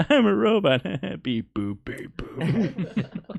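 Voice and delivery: high robot voice, breaking into laughs; robot beeps as a silly dubstep remix; playful, giggly robot act. Pretend. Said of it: "the Doug step version of this".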